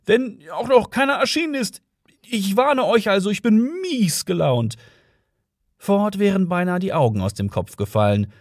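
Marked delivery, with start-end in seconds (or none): 0.0-4.8 high-pitched touchy cop; second policeman warning. A narrator's reading. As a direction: warn them as a peevish policeman. Second policeman warns of bad mood.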